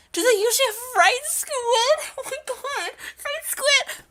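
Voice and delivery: very high, squeaky cartoon voice; high-pitched excitable character; excited game character.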